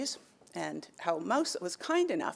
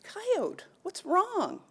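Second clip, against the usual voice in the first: tiny, very high squeak of a mouse; a small, much higher voice calling out gently; high little mouse voice asking what's wrong; 13 semitones higher, much slower than usual. A narrator's reading. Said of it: "a little voice says".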